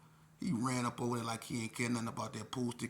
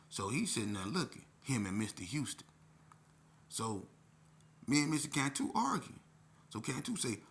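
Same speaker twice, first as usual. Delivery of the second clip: ordinary delivery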